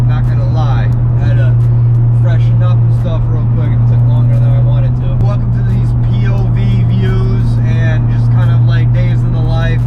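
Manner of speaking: loud, energetic vlog talk. Real, not acted. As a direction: talk loud over the wind.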